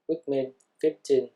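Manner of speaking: ordinary delivery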